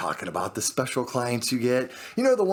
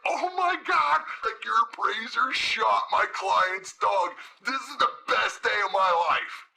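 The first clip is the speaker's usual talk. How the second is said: high, giddy delight; gushing, hammed-up glee; overjoyed client; 8 semitones higher than usual. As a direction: imitate a client thrilled beyond belief. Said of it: "this is what I heard on the other end".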